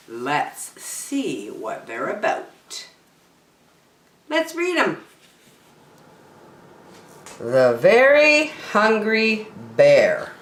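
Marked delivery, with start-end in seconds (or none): 4.3-5.0 excited. Acted. Excited start to reading. 7.4-10.3 title announcement. A narrator's reading. Reads book title aloud.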